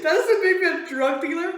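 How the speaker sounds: high, loud, cracking up; indignant but giggling; laughing protest. Retold or imitated. Teller protesting the accusation, laughing loudly. Outraged quote breaking into laughter.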